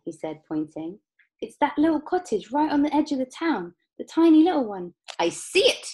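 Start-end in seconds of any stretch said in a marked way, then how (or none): 1.4-4.9 high, loud excited pointing-out; eager child. A narrator's reading. Charlie eagerly points out his house. 5.2-5.9 high, loud exclamation; Mister Wonka crying out. A narrator's reading. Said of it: "cried Mister Wonka".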